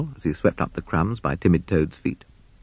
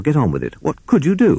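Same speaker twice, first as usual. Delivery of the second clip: impatient; 9 semitones higher, 4 dB louder than usual. Acted. Owl hurries Rat along.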